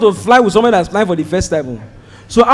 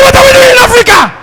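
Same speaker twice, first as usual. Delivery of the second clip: high, loud frantic yelling; much higher, crying out in disbelief; panicked shout; 14 semitones higher, 4 dB louder, faster than usual. Retold or imitated. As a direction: shout it in outrage at the detour. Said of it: "Oga, you are shouting".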